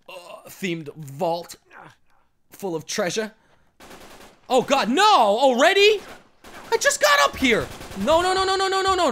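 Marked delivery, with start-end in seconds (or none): 4.5-9.1 high, loud string of no's; real frantic protest; panicked yelling. Real, not acted. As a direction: wail in disbelief at dying. Dies in game, yelling.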